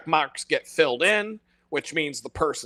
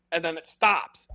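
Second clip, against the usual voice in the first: normal talking voice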